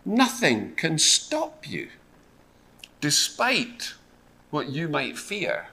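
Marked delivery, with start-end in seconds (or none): none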